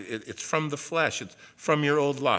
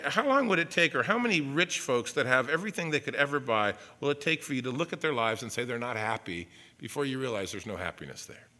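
usual speaking voice

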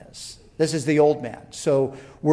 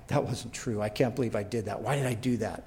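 low, soft muttered regret, acted out; quiet self-reproach; 4 semitones lower, 6 dB quieter, faster than usual. Believer convicted of his own lie, quietly self-reproachful. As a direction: play kicking yourself under your breath.

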